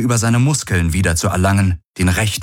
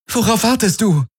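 much higher, impatient question; urgent. A narrator's reading. Kirai presses Galen to act, impatient. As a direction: push him to move.

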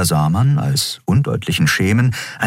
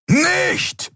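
very high, desperate cry; roar. A narrator's reading. Keru roaring at Nelen to stop, very high. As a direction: shout to stop her. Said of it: "brüllte Keru".

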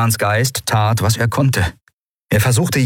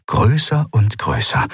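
ordinary delivery, nothing marked

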